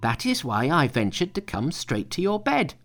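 high, earnest monkey voice; high-voiced Monkey. A narrator's reading. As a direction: play Monkey pitched well up. Monkey explains himself.

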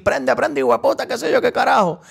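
high, rowdy hype call; imitating a hype man. Retelling [name] hyping the fireworks, mock excited. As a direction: fire up the crowd like Ñengo. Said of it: "que [name] empezó a decir".

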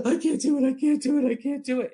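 gasp, then high, loud frantic repetition; hammed-up overwhelmed wail; hysterical, acted. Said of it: "I was crying and laughing and hysterical".

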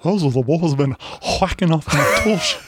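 Texan dad complaint in a put-on voice; Hank Hill voice. Joining in on the Hank Hill bit, Texan grumble. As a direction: grumble like a Texas propane dad. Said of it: "a Hank Hill".

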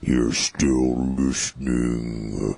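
low, slow creepy taunt. Acted. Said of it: "using my creepy voice".

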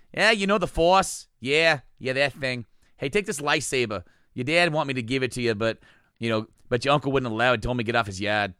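put-on jokey Boston guy accent. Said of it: "funny Boston made up quotes".

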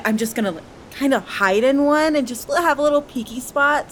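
ordinary delivery

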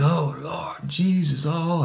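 slow, high drawn-out moan; anguished wail. Retold or imitated. Suspect on his knees calling on Jesus, slow high wail. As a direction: stretch it out like you're in agony.

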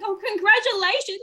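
high, sing-song congratulations; mock gushing well-wisher. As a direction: imitate people gushing over you. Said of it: "Someone would be like".

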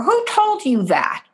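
indignant parent. Retold or imitated. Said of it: "responds by saying something like".

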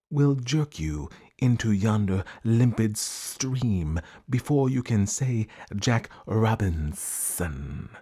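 very slow, drawn-out pompous serpent; python rock snake. Acted. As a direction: stretch the long words out with ceremony. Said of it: "This is the way bi-colored python rock snakes always talk".

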